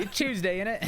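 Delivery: put-on a playful stab at a British accent. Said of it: "It's the only thing I can say perfectly".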